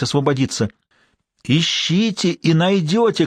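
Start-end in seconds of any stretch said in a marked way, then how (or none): 1.4-3.3 high, slow, pleading cry across the river; desperate shout. Acted. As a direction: call out slowly, urgently. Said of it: "кричала Лэнгдону незнакомка".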